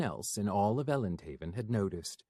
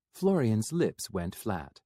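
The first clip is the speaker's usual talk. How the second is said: ordinary delivery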